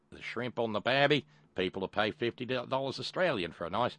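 hammed-up Australian accent; cartoon Australian pitchman; Crocodile Kincaid. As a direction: sell it in a broad mock-Aussie accent. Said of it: "I probably should have used the Crocodile Kincaid voice".

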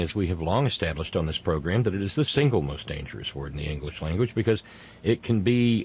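usual speaking voice

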